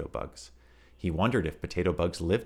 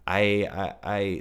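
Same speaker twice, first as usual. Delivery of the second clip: stammering; 3 semitones higher, 5 dB louder, much slower than usual. Acted. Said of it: "stammered Tom".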